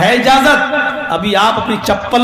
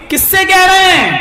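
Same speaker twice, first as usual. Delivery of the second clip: puzzled question; 9 semitones higher, 3 dB louder than usual. Acted. Man asks who.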